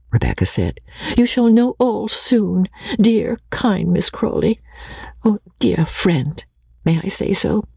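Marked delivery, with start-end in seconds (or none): 1.2-7.6 higher, plaintive sweetness; tearful appeal. A narrator's reading. Rebecca to dear kind Miss Crawley, tearfully sweet. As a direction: appeal meekly through tears.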